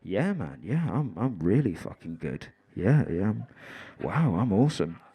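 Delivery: low, dazed stoner voice; drugged-out raver. Acted. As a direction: play a man blissed out on tranquilizer. Acting man drugged on horse tranquilizer.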